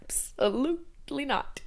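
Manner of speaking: soft, slow, high-pitched no; emphatic refusal. Real, not acted. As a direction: stretch it out syllable by syllable, softly. Repeats the refusal, drawn out.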